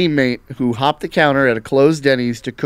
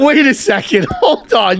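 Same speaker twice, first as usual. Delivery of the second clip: amused disbelief; 8 semitones higher than usual. Real, not acted. Disbelief at Latin in high school.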